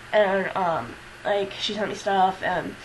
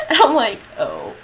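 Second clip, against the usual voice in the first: said through laughter, louder; laughing; 7 dB louder than usual. Real, not acted. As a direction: let the laugh spill over the words. Laughing at friends' answer.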